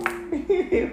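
a quick laugh that jumps high. Real, not acted. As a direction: burst into a short laugh at her own line. Laughs after "get the hell on.

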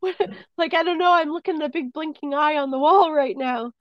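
high, loud mock disbelief; incredulous reaction. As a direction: retell it as a loud, high-pitched freak-out. Retold reaction to the apparition.

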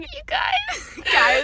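high squealing laugh through tears; laughing cry. Real, not acted. Fan laughing and crying over the movie, squealing. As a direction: crack up while half crying.

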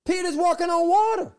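preacher soaring at the peak; ecstatic preaching. Real, not acted. Preacher's climax on Peter walking.

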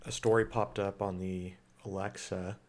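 normal talking voice

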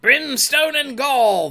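slow, high pirate bellow; drawn-out roaring oath; loud retort. A narrator's reading. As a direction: retort as a blustering captain. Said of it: "the voice retorted".

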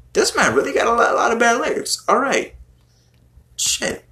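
incredulous. Real, not acted. Incredulous at [name] lyrics.

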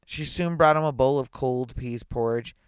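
plain reading voice

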